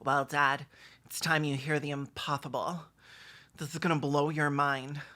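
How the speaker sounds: usual speaking voice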